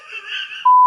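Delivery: shrieking burst of laughter; loud high laugh. Real, not acted. Laughing at adopted joke.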